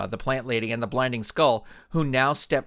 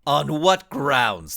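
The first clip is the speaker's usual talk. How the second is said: slow, louder, drawn-out villain challenge; 4 dB louder, much slower than usual. Acted. Blinding Skull challenging the superhero, slow villain drawl. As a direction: drag out the challenge, unimpressed. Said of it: "the Blinding Skull replied".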